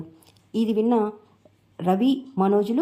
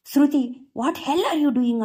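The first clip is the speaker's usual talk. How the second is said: high, drawn-out scolding yell; mild narrated shout; angry shout; 6 semitones higher, much slower than usual. A narrator's reading. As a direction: stretch the English words out as she shouts. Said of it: "అంటూ గట్టిగా అరుస్తుంది".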